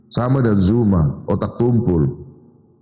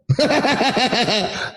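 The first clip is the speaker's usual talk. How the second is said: a short scornful laugh, pitched up; mocking laugh; 3 semitones higher than usual. Real, not acted. Apologist laughs at his challenger, mocking.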